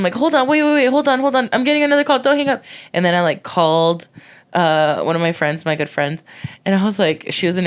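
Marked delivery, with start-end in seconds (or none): 0.1-2.6 urgent. Retold or imitated. Retold stalling him on phone.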